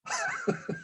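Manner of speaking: a short, high laugh after the punchline; real laugh. Real, not acted. Host laughing at his own punchline, real laugh.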